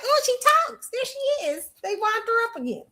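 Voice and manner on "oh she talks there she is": squealed high, shocked fan; mock surprise. Fan amazed that [name] finally speaks, high mock shock. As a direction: gasp it out way up high like a stunned fan. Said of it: "I was like".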